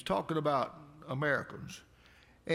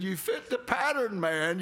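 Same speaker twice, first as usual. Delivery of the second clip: high, loud, laughing through the words; amused laughing apology; 5 semitones higher, 4 dB louder than usual. Retold or imitated. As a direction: laugh while explaining. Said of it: "I said".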